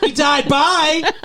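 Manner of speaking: high, loud comic blurt, drawn out; hammed-up awkward phone farewell; mock hasty sign-off. Acted blurted hang-up on a call.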